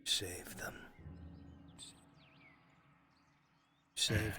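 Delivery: low, soft, menacing whisper of guilt; hushed accusing narration. Acted. GM whispering to the guilty character, low and accusing. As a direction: murmur it like a haunting conscience.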